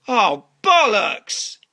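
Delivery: exasperated curse. Acted. John realises he has no passport, exasperated drawn-out curse. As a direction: draw the swear word out in frustration.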